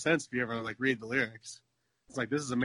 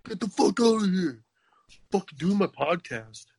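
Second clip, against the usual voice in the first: higher, louder mock yell at an intruder; mock freak-out; 6 semitones higher, 4 dB louder than usual. Podcaster yelling at someone doing laundry, mock angry. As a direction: snap at whoever walks in.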